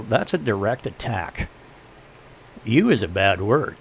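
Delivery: ordinary talk, nothing marked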